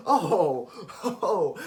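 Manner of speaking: loud disbelieving laugh. Real, not acted. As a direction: burst out laughing at the excuse. Laughing at her excuse.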